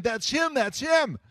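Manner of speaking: high-pitched cry of sudden recognition; excited yelling. Retold or imitated. Woman yelling at a picture on the wall, excited. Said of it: "she starts yelling".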